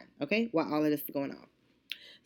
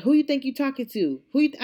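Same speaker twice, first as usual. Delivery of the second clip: loud, high indignant challenge; heated comeback, retold; retold confrontation; 8 semitones higher, 5 dB louder, faster than usual. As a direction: mimic an affronted customer. Acted quarrel, customer snaps back.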